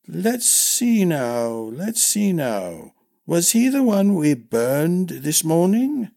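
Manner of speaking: slow, doddering musing; forgetful old master-at-arms. Acted. Old master trying to remember the king, slow and doddering. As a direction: think it out slowly as a confused old man.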